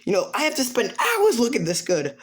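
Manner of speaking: high-pitched mock indignation; worked-up, high acted whine; whiny complaint. Drunk character complaining before crying.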